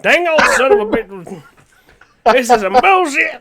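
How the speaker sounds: put-on high, loud Southern-accented cussing; hammed-up Southern rant; Boomhauer. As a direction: cuss in a thick, angry Southern accent. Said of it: "turn into borderline Boomhauer from Hank of the Hill".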